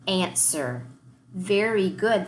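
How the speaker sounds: ordinary delivery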